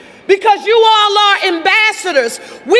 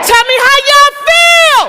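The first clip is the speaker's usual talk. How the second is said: much higher and louder, shouted to the crowd; full-voice rally call; 6 semitones higher, 5 dB louder than usual. Real, not acted. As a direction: let it ring out to the whole arena. Rally call after whispered practice.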